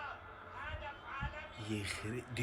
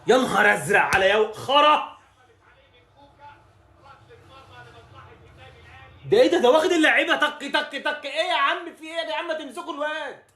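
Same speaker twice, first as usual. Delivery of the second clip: much higher, loud, frantic; panicking at the dribble; frantic yelling; 10 semitones higher, 5 dB louder than usual. Real, not acted. Fan watching a player dribble past everyone, frantic yelling. Opponent dribbling through defence.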